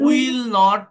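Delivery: loud questioning echo of the student's "Will not"; echoing the wrong answer. Shown as a demonstration. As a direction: repeat "Will not" back as a mistake. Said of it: "এইভাবে ফুল ফর্মে not বলা যায় না". (ভুল উত্তরটা জোরে প্রশ্নের সুরে ফিরিয়ে বলা)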